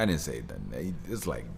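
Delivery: ordinary talk, nothing marked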